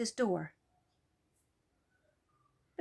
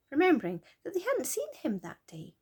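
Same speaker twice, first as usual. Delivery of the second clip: ordinary delivery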